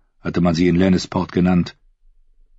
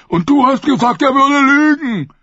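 simple fool voice; 5 dB louder than usual. A narrator's reading.

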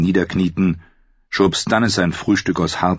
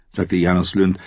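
ordinary talk

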